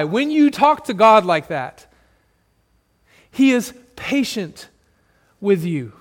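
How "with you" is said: usual speaking voice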